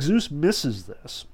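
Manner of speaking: ordinary talk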